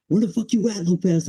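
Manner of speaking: a high, barking mock drill instructor yell. Retelling a drill instructor yelling for him, mock yell. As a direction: bark it like a drill sergeant storming the barracks.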